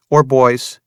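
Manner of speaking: put-on a touch firmer young woman; Vanessa, firm. A narrator's reading. Vanessa voiced by narrator.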